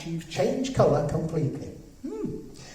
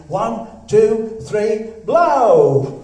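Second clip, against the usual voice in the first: loud countdown; 5 semitones higher, 9 dB louder, much slower than usual. Acted. Counting the kids in to blow.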